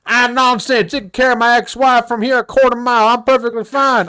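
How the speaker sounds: high-pitched mock country customer. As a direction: insist in a put-on country twang, pitched high. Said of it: "the country voice".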